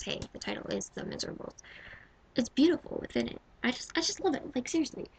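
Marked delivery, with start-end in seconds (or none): none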